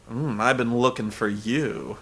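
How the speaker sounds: low, slow, soft mock seduction; suggestive come-on. Host mimicking her turning it into sex, sultry and slow. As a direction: turn it into a drawn-out innuendo.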